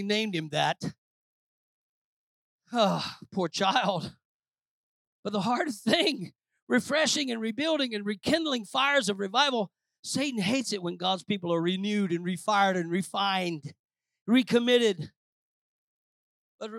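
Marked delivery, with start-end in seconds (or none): none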